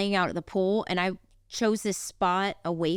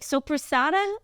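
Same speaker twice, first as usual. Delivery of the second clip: higher-pitched, lightly attempted Italian accent; 7 semitones higher than usual. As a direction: have a tentative go at an Italian accent. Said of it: "I can't do an Italian accent like she does".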